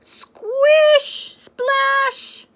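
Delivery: high loud splashy sound effect. Pretend. Reading to a child, loud splashy puddle noises. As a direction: squeal the splash like a cartoon.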